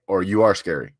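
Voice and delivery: ordinary talk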